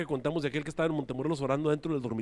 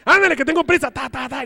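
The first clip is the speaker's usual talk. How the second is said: high, loud, frantic yelling; desperate shout; 9 semitones higher, 10 dB louder than usual. Retold or imitated. Desperate roommate yells high and loud to be let in. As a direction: hammer the door and yell desperately. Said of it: "el otro se desespera".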